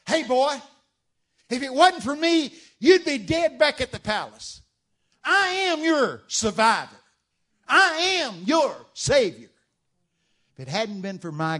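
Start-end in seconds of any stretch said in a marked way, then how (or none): 0.1-9.5 loud, high ranting uncle voice; booming, self-righteous uncle laying a guilt trip; scheming uncle. Acted. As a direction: shout it down at the boy like a bullying uncle. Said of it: "brainwashed like this by his uncle".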